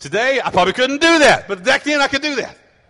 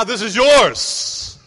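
sarcastic; 4 dB louder, much slower than usual. Real, not acted. Mocking kid's claim.

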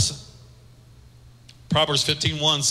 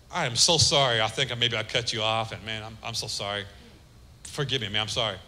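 humble apology; 8 semitones lower, 5 dB quieter than usual. Retold or imitated. Apologizing to road-rage driver.

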